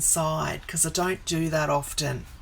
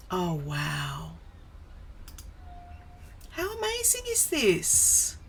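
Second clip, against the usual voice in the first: amazed; 4 dB quieter than usual. Real, not acted. Streamer shows viewers the new app, amazed and gushing.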